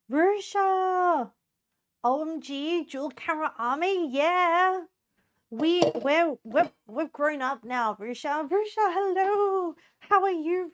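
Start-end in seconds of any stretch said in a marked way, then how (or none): 0.1-4.8 high, loud happy call-out; excited greeting. Real, not acted. 8.5-10.7 beam it out to a friend; excited greeting. Real, not acted.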